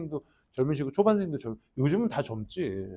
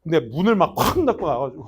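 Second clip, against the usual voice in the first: ordinary talk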